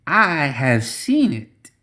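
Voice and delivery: lightly voiced scheming witch, measured; a touch of a slow ominous seer; Zoraida voice. A narrator's reading.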